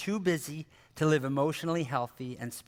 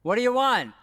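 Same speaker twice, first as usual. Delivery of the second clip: put-on higher, louder, put-upon kid; annoyed kid reply; 7 semitones higher, 6 dB louder than usual. Kid answers a parent's call, annoyed and loud. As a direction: holler back as an interrupted child.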